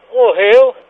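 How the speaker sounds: loud blurt. Pretend. Prank caller repeats odd answer.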